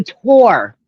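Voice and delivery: tour drawn out slowly as "tor"; correctly said tour. Shown as a demonstration. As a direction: say tour with a full "or", rhyming with four. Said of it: "Okay, you got that right".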